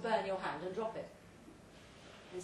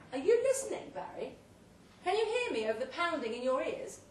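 louder, much higher call to get his attention; 6 semitones higher, 6 dB louder than usual. Acted. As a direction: shout through the pounding in his ears. Narrator calls out to Barry.

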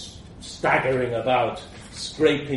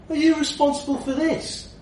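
sharp demand, much higher and louder; 5 dB louder than usual. Acted. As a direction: confront the roommate head-on. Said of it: "I demanded".

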